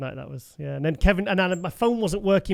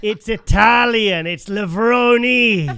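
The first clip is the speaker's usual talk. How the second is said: loud, drawn-out mock correction; telling off; 3 semitones higher, 8 dB louder, much slower than usual. Bodybuilder correcting his name by voice note, loud mock scolding. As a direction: stretch it out big and loud, Italian ee ending. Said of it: "telling me off".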